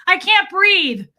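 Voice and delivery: high, loud, gasping panic that slows on the last words; a hammed-up claustrophobic panic; mock panic. Retelling her panic in the stuffy gym, high and loud.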